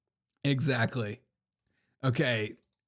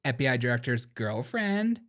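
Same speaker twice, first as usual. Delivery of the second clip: put-on Valley girl upspeak, rising at the end; mock Valley girl; 2 semitones lower than usual. Host mocking the director's upspeak, mock Valley girl. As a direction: mimic a Valley girl lilt. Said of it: "start talking like that".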